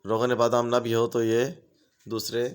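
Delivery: usual speaking voice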